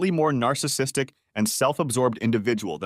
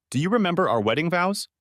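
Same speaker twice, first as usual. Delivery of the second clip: aggressive; 7 semitones higher than usual. Acted. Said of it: "I asked aggressively".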